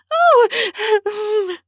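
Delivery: high, loud breathy yelp. A narrator's reading. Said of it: "I yelped".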